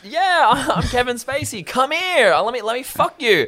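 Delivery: high, loud hammed-up caricature; Kevin Spacey. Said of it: "imagine if I went".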